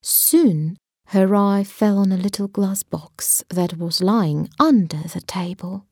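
ordinary delivery, nothing marked

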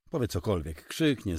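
ordinary delivery

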